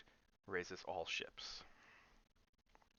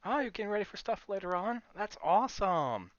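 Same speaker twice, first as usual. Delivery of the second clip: high, loud, delighted; excited; 11 semitones higher, 9 dB louder than usual. Real, not acted. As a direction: cheer at the news. Reacting to chat excitedly.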